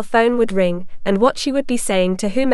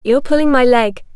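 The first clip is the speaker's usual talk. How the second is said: exasperated; 3 dB louder than usual. A narrator's reading. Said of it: "in an exasperated tone".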